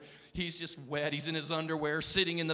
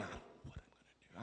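breathless retold whisper; 9 semitones lower, 22 dB quieter, much faster than usual. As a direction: let it fall to a helpless whisper. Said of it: "I'm looking at Clay going".

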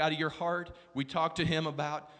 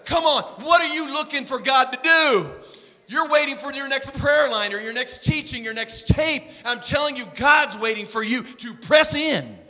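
louder, higher, pressing call to the church; preaching at full force; 8 semitones higher, 9 dB louder than usual. Acted. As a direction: preach it hard, driving each line home. Preaching climax to congregation.

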